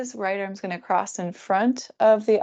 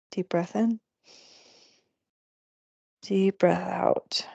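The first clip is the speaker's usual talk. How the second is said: slow breathy whisper; slower than usual. Acted. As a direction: breathe the words out slowly in a whisper. Guiding breath, repeated cue.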